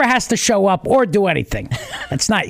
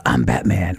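very deep superhero voice; Batman. Shown as a demonstration. Host shows co-hosts the deepened superhero voice. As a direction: drop the voice right down into a dark growl. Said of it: "deepen your voice when you're actually Batman".

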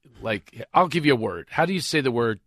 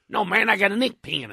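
higher imitated Texan, "pen" as "pin"; mock Texas twang, raised pitch; Texas accent; 7 semitones higher than usual. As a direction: insist like a Texan golfer. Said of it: "I was playing golf in Texas".

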